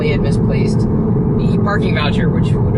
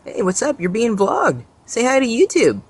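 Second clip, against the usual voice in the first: high sing-song voice for a bunny; chirpy pet-talk greeting; cutesy animal talk; 9 semitones higher than usual. Real, not acted.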